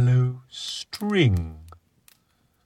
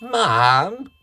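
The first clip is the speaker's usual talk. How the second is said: put-on high child's voice calling out; little boy calling; 10 semitones higher, 3 dB louder, slower than usual. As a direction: call for mum like a small boy. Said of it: "finally exclaimed".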